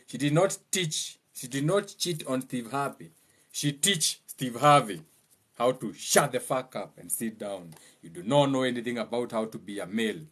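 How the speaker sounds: normal talking voice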